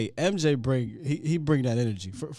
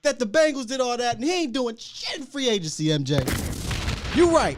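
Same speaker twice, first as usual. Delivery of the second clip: worked-up rant; 11 semitones higher, 4 dB louder than usual. Real, not acted. Host riling up caller over the owner, heated and high. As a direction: voice shoots up high and loud, ranting.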